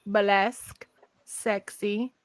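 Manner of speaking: put-on British listing; mock-British, item by item; British accent. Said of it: "say it in a British accent".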